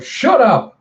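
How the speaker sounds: high, loud outburst; sharp acted scream, pitch leaping up. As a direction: scream it to silence someone. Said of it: "as she screams out".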